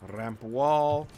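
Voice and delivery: Ramp wall with a trilled rolling r; rolled r. Real, not acted. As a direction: roll the r in Ramp hard. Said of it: "I just rolled my R's".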